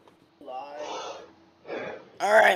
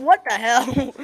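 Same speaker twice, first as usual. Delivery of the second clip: laughing; 9 semitones higher, 7 dB louder, slower than usual. Real, not acted. Laughs at own slip.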